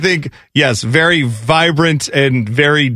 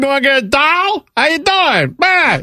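high-pitched hammed-up Chicago accent; mock Chicago accent; 10 semitones higher than usual. Co-host mocking partner's hometown speech, broad Chicago accent. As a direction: put on an exaggerated Chicago guy's accent. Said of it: "is that how Chicago people talk".

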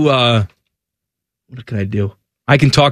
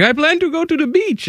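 mock traveler; 13 semitones higher, 4 dB quieter than usual. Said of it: "people are like".

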